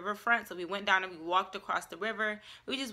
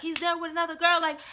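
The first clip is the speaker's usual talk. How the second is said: upset friend quote; 7 semitones higher, 3 dB louder than usual. Retold or imitated. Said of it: "She's like".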